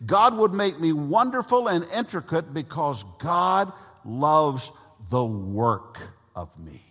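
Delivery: normal talking voice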